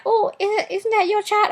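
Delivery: high, sing-song imitation of nosy strangers; mocking nosy people. Mocking people who ask if the clingy girl is her child, high and sing-song. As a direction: ask it like a prying busybody, up high. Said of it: "People try to be like".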